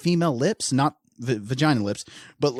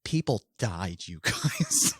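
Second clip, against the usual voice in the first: mock scolding; 5 semitones lower, 5 dB quieter, slower than usual. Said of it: "who's like".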